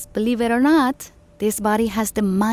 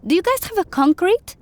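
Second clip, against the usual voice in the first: much higher, excited question; eager question; 11 semitones higher, 3 dB louder than usual. Acted. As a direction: ask it with sudden enthusiasm. Eager engineer asking.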